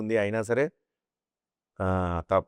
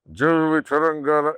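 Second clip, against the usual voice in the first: mild louder rallying call; mimicry artist slogan; 3 semitones higher, 4 dB louder, slower than usual. Other artists' typical stage slogan, mildly raised. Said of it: "dialogue చెప్పాలంటే".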